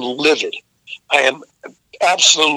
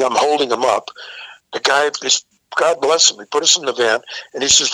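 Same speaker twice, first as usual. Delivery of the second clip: normal talking voice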